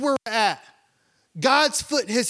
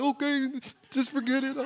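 put-on sulky child; 6 semitones higher, 3 dB quieter than usual. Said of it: "he's like".

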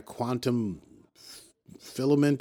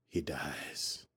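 hushed; 8 semitones lower, 12 dB quieter than usual. Retold or imitated. Dramatic twist in the recap.

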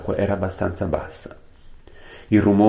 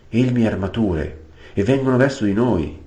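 higher, rising alarm; alarmed exclamation; 6 semitones higher, 4 dB louder than usual. Acted. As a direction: realize the danger as you speak. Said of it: "esclamò Winnie".

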